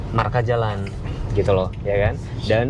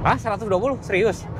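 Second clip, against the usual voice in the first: surprised; 9 semitones higher than usual. Real, not acted. Surprised at the car's speed.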